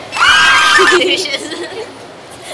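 big burst of loud laughter. Real, not acted. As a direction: let out a loud shouting laugh.

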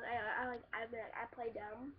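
usual speaking voice

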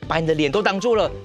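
plain reading voice

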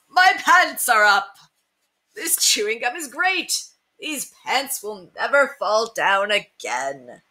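proud and thrilled, pants up; happy old mouse. Acted. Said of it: "cried the old mouse".

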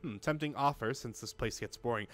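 usual speaking voice

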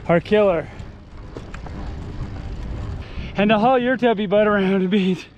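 panting while pedaling uphill; puffing out words on a climb; out of breath. Real, not acted. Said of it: "this hill is killing me".

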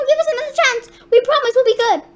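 high, loud begging; hammed-up panicked plea; frantic pleading. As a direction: beg the monster for mercy. Begging monster for mercy.

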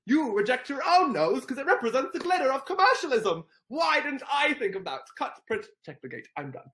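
loud, high sarcastic Grinch, fading out; hammed-up Grinch sarcasm; mock Grinch. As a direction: gush sarcastically, then trail off. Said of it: "I love when it says".

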